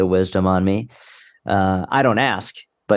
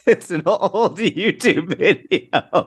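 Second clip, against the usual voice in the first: high, slow words through laughter; fighting back giggles; laughing; 10 semitones higher, much slower than usual. Real, not acted. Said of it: "what are you laughing at".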